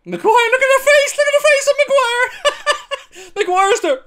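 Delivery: high, fast words through laughter; gleeful mocking through giggles; squealing with laughter at a defender's face; laughing. Real, not acted.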